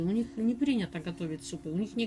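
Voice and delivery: speaking between bites; talking while chewing. Real, not acted. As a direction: mouth partly full of pie. Seen 0: Bites pie at -2; cheeks full, chewing motions while talking.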